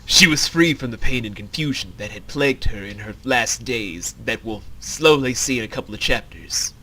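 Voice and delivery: low, measured narrator; low-pitched narrating voice; story narration. A narrator's reading. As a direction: read it as a low, even storyteller. Reads the script's narration.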